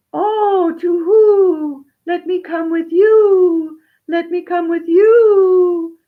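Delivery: high, loud, slow pleading owl; owl's long, high wailing call; hooting owl. Acted. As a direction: hoot it out, drawing out each final vowel. Owl begs to come along.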